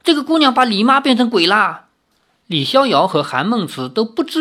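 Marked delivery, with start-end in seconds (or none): 0.0-1.9 high-pitched cry of alarm; panicked, high and raised voice; frightened screaming. Acted. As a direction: cry out as if you had seen a zombie. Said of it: "吓得哇哇大叫".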